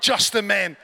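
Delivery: very loud mock-angry order; angry command. Gathering leader calls the men in to sing, angrily. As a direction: bellow it like a cross worship leader. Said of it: "you know, quite angrily".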